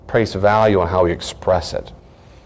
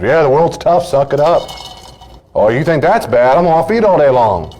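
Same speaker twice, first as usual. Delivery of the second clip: loud, high-pitched mock scolding; hammed-up tough-love scoffing; dismissive parent; 7 semitones higher, 5 dB louder than usual. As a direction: belt it out like a parent brushing off tears. Mocks dismissive authority figures.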